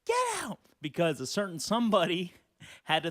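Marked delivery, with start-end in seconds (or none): 0.0-0.6 much higher, loud mock screaming of the arcade owner; imitated furious yell. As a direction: shriek her out the door. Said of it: "She's like".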